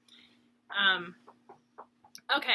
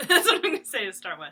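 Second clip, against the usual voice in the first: laughing it out, louder and faster; 10 dB louder, faster than usual. Real, not acted. Laughing at herself to her viewers. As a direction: giggle through the line at your own rambling.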